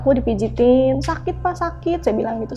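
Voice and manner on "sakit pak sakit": higher, softened cry of pain, imitated; mildly acted pleading, not really crying; retold tearful plea. Child patient to healer pressing the head, retold tearful plea. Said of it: "nangis bombay".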